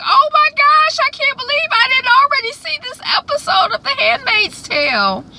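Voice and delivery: very high, loud gushing disbelief; excited squeal. Real, not acted. Reacting to her own realization.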